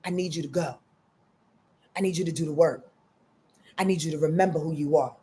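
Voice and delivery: low, soft, measured urging; stern-kind Saturn. Acted. Saturn urging someone back to work, lightly voiced quiet insistence. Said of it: "Saturn's like".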